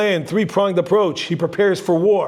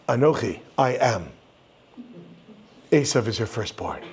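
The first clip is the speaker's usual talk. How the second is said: "Anochi" set apart, a long pause, then "Esav is your firstborn" said lower; pause after Anochi; 7 semitones lower, 4 dB quieter than usual. Shown as a demonstration. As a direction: break it after "Anochi", as if "I am" ends the sentence. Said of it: "which goes something like this".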